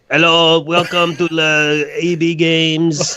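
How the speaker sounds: a hammed-up French-Canadian clerk in a thick French accent; mock French accent. Telling friends about the phone call to the game store, in a mock French accent. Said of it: "where I'm in a French town".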